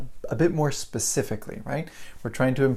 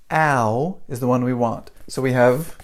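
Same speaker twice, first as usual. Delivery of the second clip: ordinary delivery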